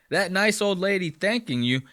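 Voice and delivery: ordinary talk, nothing marked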